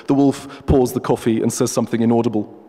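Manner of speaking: ordinary delivery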